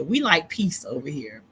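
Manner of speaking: ordinary talk, nothing marked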